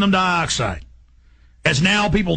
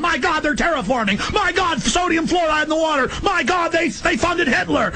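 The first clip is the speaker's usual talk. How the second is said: high, alarmed exclamations, voice pitched up; freaked-out outcry; 10 semitones higher than usual. Retold or imitated. To radio listeners, voicing his own freaked-out alarm. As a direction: cry out in alarm at each revelation. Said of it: "I'm going".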